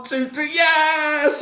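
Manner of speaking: slow three-count ending in a high yes; excited count. Retold or imitated. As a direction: count the pin like a thrilled fan. Counting the winning pin.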